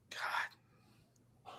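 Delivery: breathy under-the-breath whisper. Real, not acted. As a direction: say it to yourself, almost silent.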